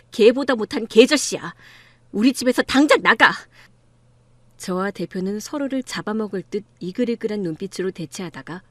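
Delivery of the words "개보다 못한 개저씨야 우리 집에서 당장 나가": loud, forceful order to leave; angry dismissal. Acted. Daughter throwing her father out of the house, angry dismissal. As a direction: throw them out in anger.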